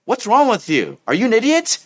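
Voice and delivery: high-pitched, heated insult; worked-up, scornful questions; angry questioning. Acted. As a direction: lay into them, acted outrage. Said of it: "angry content".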